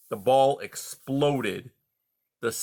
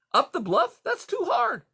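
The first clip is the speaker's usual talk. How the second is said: much higher, whiny cub voice; whining protest. Acted. Young fox complains to the porcupine, whining. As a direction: complain it's too hard. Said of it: "Tumble cried".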